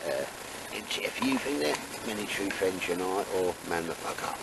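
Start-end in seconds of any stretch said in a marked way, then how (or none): none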